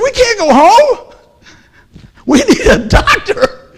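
high, loud, slow cry with a laugh at the end; re-create the panic with a half laugh; retold desperate shout. Retold reply to his wife in pain.

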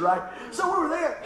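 ordinary talk

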